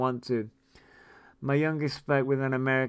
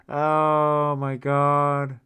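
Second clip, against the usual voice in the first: long, slow amazed exclamation; drawn-out oh my God; 4 dB louder, much slower than usual. Real, not acted. Hearing her son gets a perm, slow amazed exclamation. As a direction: stretch it out slowly in amused disbelief.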